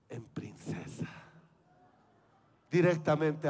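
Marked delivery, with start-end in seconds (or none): 0.1-1.1 hushed breathy whisper. Acted. Describing Carlita's change, in an awed whisper. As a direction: whisper it in awe. Dreamy reveal of princess.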